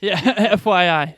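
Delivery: laughing through the words, slowed. Real, not acted. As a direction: chuckle while saying it. Laughing at longer episode joke.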